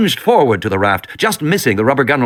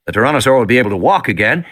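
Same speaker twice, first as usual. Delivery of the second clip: plain reading voice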